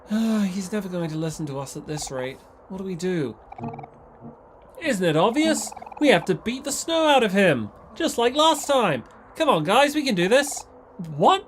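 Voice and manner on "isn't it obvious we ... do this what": eager high fighter voice. Acted.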